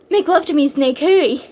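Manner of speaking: loud, frenzied plea; hammed-up desperate desire; frenzied urging. A narrator's reading. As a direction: urge him on urgently and loudly. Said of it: "as if she were in a kind of sexual frenzy".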